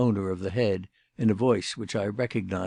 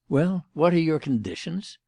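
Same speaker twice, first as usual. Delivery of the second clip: higher, pressing impatient question; impatient demand; 7 semitones higher, 3 dB louder than usual. A narrator's reading. Inspector Badger pressing Mister Jellicoe at the window, impatient demand. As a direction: push him for an answer, impatient. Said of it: "demanded Badger impatiently".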